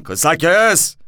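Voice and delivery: loud "Kısa kes!" barked out; sharp order. Retold or imitated. Commissioner cutting off the driver, loud order. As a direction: cut him off loudly with "Kısa kes!".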